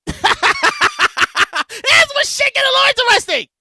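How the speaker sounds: high, hyped-up announcement; hyped-up repeat. Real, not acted. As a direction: hype up the story to come, pitched high. Hyped repeat after his laugh.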